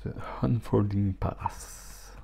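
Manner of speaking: slow, soft English title in a French accent; self-mocking French accent reading the English title. Shown as a demonstration. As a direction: read the English deck name slowly with a French accent. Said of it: "Avec mon accent for-, formidable".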